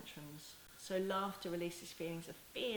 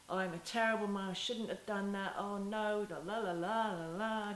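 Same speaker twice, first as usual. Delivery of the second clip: louder, mock-anxious self-reproach; self-blaming mom; 3 semitones higher, 5 dB louder than usual. Shown as a demonstration. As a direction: wail like a guilty mom beating herself up. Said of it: "can you see the posture's gone".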